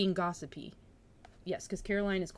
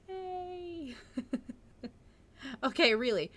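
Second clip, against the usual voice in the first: plain reading voice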